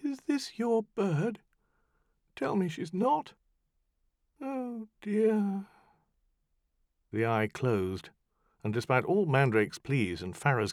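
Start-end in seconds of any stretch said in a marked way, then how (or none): 0.0-5.7 small, distant sardonic demon; faint and dry, slow start; a little far-off mocking voice; tiny voice. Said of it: "The tiny voice sounded again".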